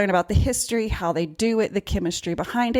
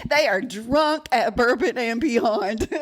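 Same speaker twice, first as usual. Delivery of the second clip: laughing; 3 semitones higher, slower than usual. Real, not acted. Laughing joke about drunks.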